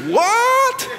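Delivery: high-pitched, hammed-up disbelief; mock incredulous. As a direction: imitate a stunned listener, voice shooting up. Acts out startled stranger's reply.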